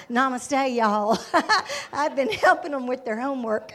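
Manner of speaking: put-on loud, joking Southern-accented greeting. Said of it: "speaking Hindi with a Southern accent".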